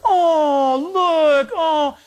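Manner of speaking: high, drawn-out mock swooning; cooing. Next passenger spotting the newborn in the aisle, cooing. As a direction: imitate a passenger melting at a baby.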